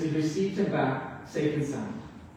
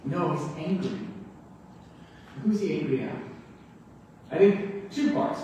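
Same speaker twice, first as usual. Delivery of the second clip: usual speaking voice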